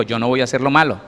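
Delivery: usual speaking voice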